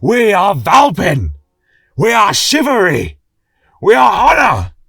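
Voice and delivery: a king whipping the crowd into a roar; rousing shout. A narrator's reading. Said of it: "with deep-seated passion".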